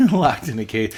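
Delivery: laughing through the words. Real, not acted. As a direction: giggle it out, amused.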